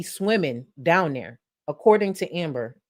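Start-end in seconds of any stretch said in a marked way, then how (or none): none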